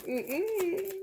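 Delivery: ordinary talk, nothing marked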